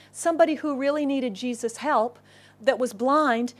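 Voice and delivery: ordinary talk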